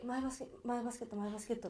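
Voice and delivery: put-on softly mumbled drunk repeat; drunken mumble. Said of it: "ベロベロで".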